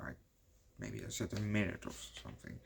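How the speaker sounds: usual speaking voice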